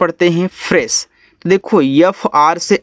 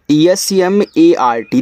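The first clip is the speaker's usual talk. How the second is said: slow, letter by letter; spelling out; much slower than usual. Shown as a demonstration. Teacher spelling a word for beginners, slow spelling out. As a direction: spell it out slowly for learners.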